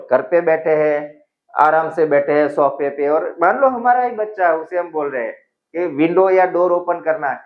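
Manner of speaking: ordinary delivery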